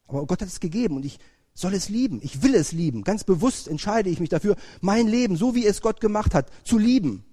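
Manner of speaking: fervent sermon climax; building preaching. Acted. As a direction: preach it with growing conviction.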